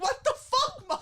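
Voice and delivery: high, laughing mock outrage at mom; comic indignation, laughter breaking in; retold outraged outburst. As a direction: protest in high-pitched disbelief. Retelling outburst at mom over photo.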